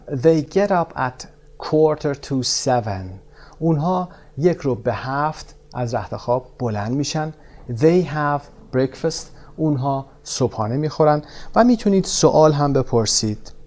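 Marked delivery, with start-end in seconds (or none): 0.1-3.2 very slow, clear English model; slow model sentence. Shown as a demonstration. Teacher modelling for pairs, very slow. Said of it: "مثلاً". 7.7-9.3 very slow, clear English model; slow model sentence. Shown as a demonstration. Teacher modelling for pairs, very slow. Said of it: "مثلاً".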